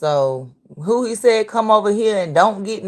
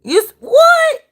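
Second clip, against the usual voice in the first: stunned disbelief; 8 semitones higher, slower than usual. Real, not acted. Reacting to the clip, a real stunned shout of disbelief. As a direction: blurt it out, can't believe it.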